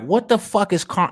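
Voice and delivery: high, exasperated outburst, cut off; retold exasperated snap; frustrated. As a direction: blurt it out in frustration. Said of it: "I'm just getting frustrated".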